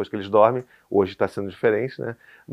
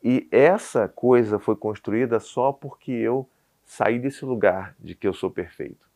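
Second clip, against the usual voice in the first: ordinary delivery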